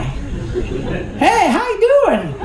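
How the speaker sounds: high, hammed-up Southern greeting; mock Southern accent. Pastor pretending to be a Southern guy on the phone, mock Southern accent. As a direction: put on a playful Southern holler. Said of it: "pretend I was like that Southern guy".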